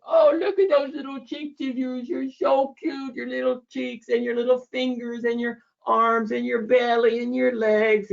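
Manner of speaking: put-on high, singsong baby talk to a newborn; mom baby talk. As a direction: coo over the baby's cheeks and fingers. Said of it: "and they're talking".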